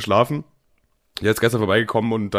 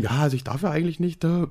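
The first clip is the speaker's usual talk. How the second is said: soft, higher awkward reply; awkward waitress; 5 semitones higher, 5 dB quieter than usual. Retold or imitated. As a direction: squirm out an awkward excuse. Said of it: "so unangenehm". (verlegen und leise)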